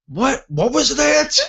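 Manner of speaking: high-pitched, startled mock alarm; retold panic. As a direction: imitate someone panicking over the radio. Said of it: "if you could hear the panic".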